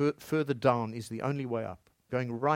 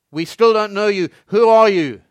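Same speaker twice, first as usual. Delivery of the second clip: loud, higher demand; a hint of a stern abbot calling out; 8 semitones higher, 16 dB louder than usual. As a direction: refuse the emperor again, firmly. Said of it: "the abbot inside would say".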